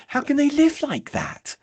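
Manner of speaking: very high, drawn-out incredulous wail; mock exasperation. Analytical appalled by the enthusiast's kitchen, exasperated. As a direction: stretch it out high in mock disbelief. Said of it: "and you just think".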